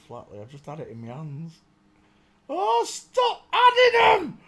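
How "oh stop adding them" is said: high, loud, slow shout; frustrated shout. Real, not acted. At the search list that keeps changing, frustrated shout. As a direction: yell at the screen in exasperation.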